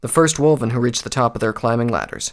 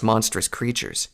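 ordinary delivery, nothing marked

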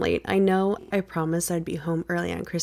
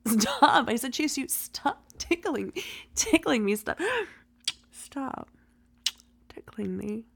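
being tickled; 6 semitones higher than usual. Acted.

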